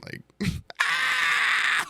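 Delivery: sudden high yelp of a scream; mock comic scream. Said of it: "like, very physical, like, screamy comedy".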